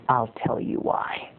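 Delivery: slow, hushed stage whisper; confiding whisper. Real, not acted. Confiding answer after a sigh.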